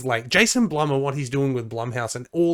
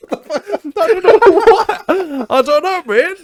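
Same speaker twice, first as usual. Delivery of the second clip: high, slow words drowned in laughter; laughing; 10 dB louder, much slower than usual. Real, not acted. Host cracks up at his own joke, laughing hard. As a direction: laugh through it helplessly.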